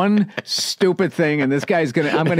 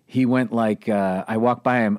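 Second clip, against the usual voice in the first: ordinary delivery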